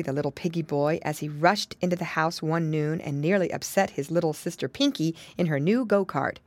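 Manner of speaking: plain reading voice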